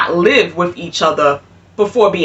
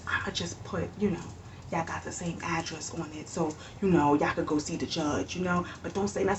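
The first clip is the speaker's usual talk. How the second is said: much softer, low conspiratorial murmur; hushed secret; 5 semitones lower, 18 dB quieter than usual. Retold or imitated. Clerk quietly tells couple to keep the favor secret, hushed. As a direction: keep it down, nobody can hear.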